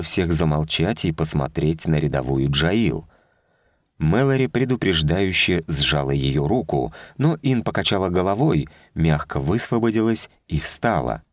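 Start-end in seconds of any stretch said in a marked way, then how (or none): none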